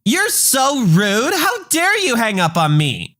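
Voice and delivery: outraged Karen. Acted. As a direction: stretch out the shocked indignation. Karen calls back, outraged.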